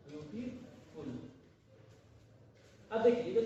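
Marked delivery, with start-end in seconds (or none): 0.1-1.1 beautiful drawn out very slowly, much softer; slow soft dictating voice; slow dictation. Shown as a demonstration. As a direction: spell out each syllable of beautiful softly.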